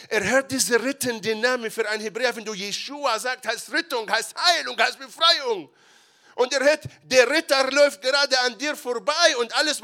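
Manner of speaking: loud, high, fervent preaching; full-force preaching. Acted. Preacher proclaiming the rescuing name to the congregation, fervent. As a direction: build it into a passionate sermon climax.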